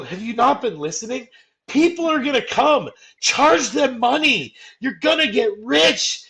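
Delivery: hammed-up ghost losing patience; mock exasperated rant. Imagined exasperated ghost lecturing Ray.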